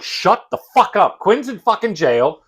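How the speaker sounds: high, slow, building to a shout; comic yell at dawdling characters; exasperated rant. Retold or imitated. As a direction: stretch the words out, fed up, ending loud.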